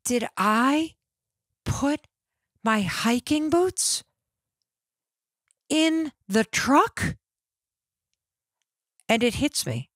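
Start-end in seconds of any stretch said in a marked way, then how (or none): 0.0-7.1 rising, slow, higher panicked question; a retold jolt of panic, drawn out; frantic panicked thought. Remembering her boots on the drive, panicked rising question. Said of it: "trying to frantically remember".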